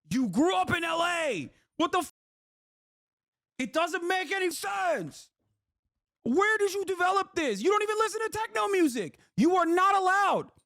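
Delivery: high, disbelieving rant; incredulous mock outrage. Ranting at the guest about his techno energy, high and incredulous. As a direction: act scandalised at the guest.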